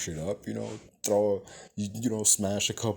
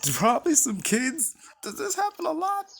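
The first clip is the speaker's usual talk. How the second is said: incredulous; 4 dB louder than usual. Real, not acted. Outraged at the cops' excuse.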